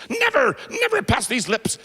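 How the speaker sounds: NEVER pushed out high, mock shout; hammed-up pious outcry; emphatic vow. Nun swearing off drink, mock shouting.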